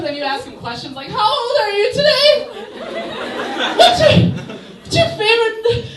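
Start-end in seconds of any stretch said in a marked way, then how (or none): 1.1-5.9 high, loud, laughing squeak; very high squeaky voice. Shown as a demonstration. Confusing the assailant with a squeaky high voice. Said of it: "higher and higher until he leaves me alone".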